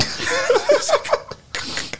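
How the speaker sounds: high burst of laughter. Real, not acted. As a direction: break into a giggle. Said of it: "I'm trying not to laugh here".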